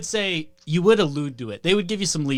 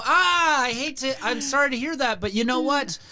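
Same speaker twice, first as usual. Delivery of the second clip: high, bright fake sympathy; chirpy telemarketer; 8 semitones higher than usual. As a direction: sympathize then pivot to the sale. Telemarketer pitch, higher, laughing.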